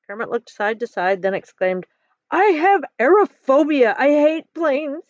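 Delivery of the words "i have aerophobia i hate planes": high, slow, loud whine; whining. A narrator's reading. Kermit afraid of planes, whining to Bob. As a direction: drag out the words in a childish protest. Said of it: "he whined".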